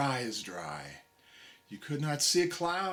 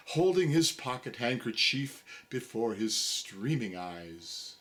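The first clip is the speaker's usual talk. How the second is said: plain reading voice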